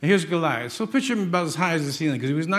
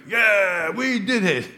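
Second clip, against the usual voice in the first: mock victory cheer, higher and louder; crowd cheering; 4 semitones higher, 4 dB louder, slower than usual. Preacher mimics everybody after David's win, mock cheer. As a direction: whoop like a crowd claiming the win. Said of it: "Now everybody's like".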